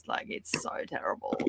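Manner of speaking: breathy whispered aside. Real, not acted. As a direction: drop to a whisper. Whispered complaint about coffee.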